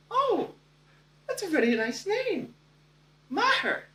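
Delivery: put-on high, delighted European-accented voice. Future wife admiring his name, European accent. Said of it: "delightful European accent".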